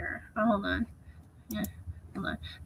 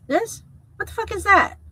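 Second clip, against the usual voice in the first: pitched way up in mocking disbelief; bigger exasperation; 9 semitones higher than usual. Real, not acted. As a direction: make it sound absurd. Repeating the outburst more strongly.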